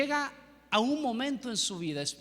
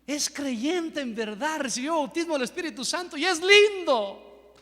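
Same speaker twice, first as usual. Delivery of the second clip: higher and louder, thrilled delight; excited girl; 5 semitones higher, 6 dB louder than usual. Retold or imitated. Girl thrilled to see him speaking in tongues, high excited voice. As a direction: gush with amazed delight.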